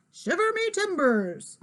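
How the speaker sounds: playful pirate cry; pirate dad. A narrator's reading. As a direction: call it out like a hammy pirate. Dad plays along as pirate.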